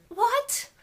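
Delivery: high, loud retold "what?"; shocked disbelief. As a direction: re-enact a stunned outburst, pitch shooting up. Retelling her shock at diagnosis.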